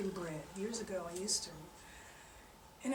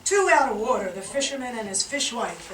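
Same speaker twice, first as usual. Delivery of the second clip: ordinary talk, nothing marked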